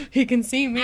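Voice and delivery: hammed-up startled alarm; mock freak-out. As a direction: imitate a girl panicking at being seen. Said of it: "starts to freak out".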